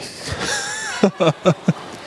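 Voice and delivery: real breathy laugh. Real, not acted. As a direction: laugh helplessly at your own story. Laughing at his own story.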